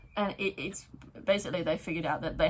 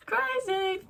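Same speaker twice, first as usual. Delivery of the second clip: high crazy; 13 semitones higher than usual. Shown as a demonstration. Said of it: "repeated crazy".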